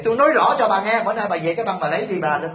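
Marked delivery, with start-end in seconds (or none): none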